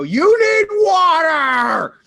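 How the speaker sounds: high, loud, slow drawn-out yell; charging the guy, bellowing water offer; battle-cry shout. Acted. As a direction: scream it as you leap on him. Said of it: "jump on the guy and go".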